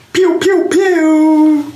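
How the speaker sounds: high, squeaky pew pew pew; playful sound-effect pews; mock laser gunshots. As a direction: fire off a cartoon laser gun. Host fires mock gun sound.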